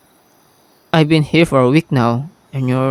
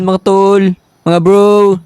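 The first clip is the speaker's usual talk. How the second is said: vendor call; 4 semitones higher, 5 dB louder, much slower than usual. Pretend. Calling out to customers.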